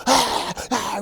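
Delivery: high, eager dog pants; imitated dog panting. Coach's story of Old Yellow rushing to the door, imitated panting. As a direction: pant like an excited young dog.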